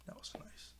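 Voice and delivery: hushed whispered aside. Real, not acted. Touched by the apology scene, whispered aside. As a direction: breathe it out softly to yourself. Aside while recapping cartoon.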